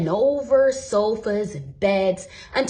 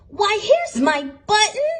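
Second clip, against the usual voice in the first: higher, louder happy cry; small bear's joyful cry; glad cry; 10 semitones higher, 5 dB louder than usual. A narrator's reading. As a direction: burst out with delight at the find. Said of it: "he cried".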